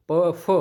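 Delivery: प plain, then फ with a strong breath push; teacher contrasting प with an aspirated फ; aspiration contrast. Shown as a demonstration. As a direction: contrast प and फ, force the air on फ. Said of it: "इसमें जोर लग रहा है".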